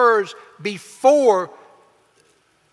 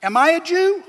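retort; 8 semitones higher, 3 dB louder than usual. Retold or imitated.

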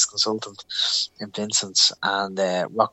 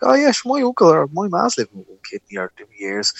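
breezy, higher-pitched voice of acquaintances; real casual; 8 semitones higher than usual. Retold or imitated. Workmates reassuring him about one kidney, breezily casual. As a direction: brush it off as nothing unusual. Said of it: "like, real casual".

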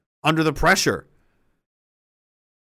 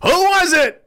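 shout; 14 semitones higher, 5 dB louder, slower than usual. Acted. Acted angry officer interrogation.

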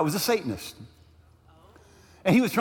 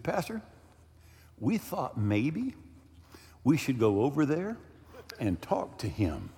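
much softer, slow, hesitant offer; timid suggestion; 5 semitones lower, 6 dB quieter than usual. Retold or imitated. Ushers tentatively offering help to the pastor, timid and soft. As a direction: tiptoe through the suggestion.

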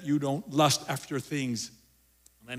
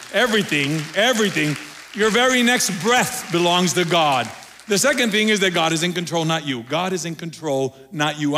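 preaching at full force; 3 semitones higher, 9 dB louder than usual. Real, not acted. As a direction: drive it home to the congregation. Preaching climax, crowd clapping.

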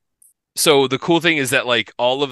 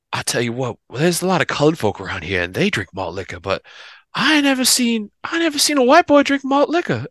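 lightly drawled Southern man, rising much higher and astonished at the end; southern dude voice; 6 semitones higher than usual. Retold or imitated. Breakfast guy Colin to Pete in the morning, high and amazed Southern voice. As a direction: play the big Southern guy floored by what he saw. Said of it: "big old dude from the South".

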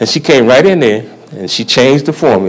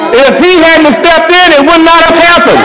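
fervent testimony; 13 semitones higher than usual. Real, not acted. Man testifying to listeners that Jesus healed his leukemia, preaching. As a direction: build to a shout of praise.

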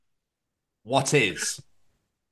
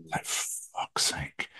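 whispered, slow exasperated curse; 3 semitones higher, 11 dB quieter, much slower than usual. Real, not acted. Host whispers his frustration about sleeping late. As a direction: breathe the curse out slowly.

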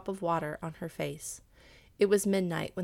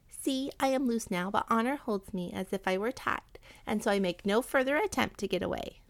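higher rat voice, starting high and easing down; honorable rat; 3 semitones higher than usual. Acted. Freed rat showing the cat its honor, earnest. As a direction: reassure your captor as an earnest little rat.

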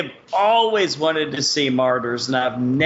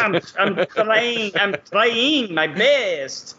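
put-on slow French accent slipping into Irish and Borat; badly faked French, drawn out; 3 semitones higher, much slower than usual. Host defending the French attempt, badly faked French accent. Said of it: "Irish mixed with Borat".

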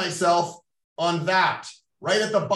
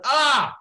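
loud, high "ah" yelp; short startled cry, pitched way up; retold yelp; 9 semitones higher, 5 dB louder than usual. Speaker imitating his reaction to the cut, yelping. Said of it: "I kinda went".

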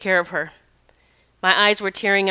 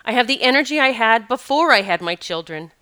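ordinary talk

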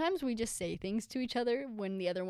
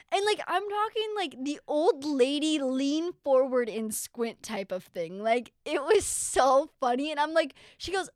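ordinary talk, nothing marked

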